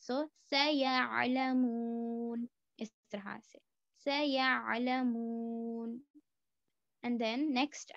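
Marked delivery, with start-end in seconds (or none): 0.5-2.5 सयालमून with the final मू drawn out long and slow; stretched final madd. Shown as a demonstration. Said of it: "दो हरकत से ज्यादा खींच कर पढ़ेंगे". 4.1-6.0 सयालमून with the final मू drawn out long and slow; stretched final madd. Shown as a demonstration. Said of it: "दो हरकत से ज्यादा खींच कर पढ़ेंगे".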